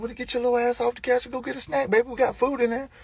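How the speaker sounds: high, soft, strained nagging; put-upon dad fussing at his kid; exasperated. Retold or imitated. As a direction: nag in a squeezed high voice. Dad snapping at lazy daughter.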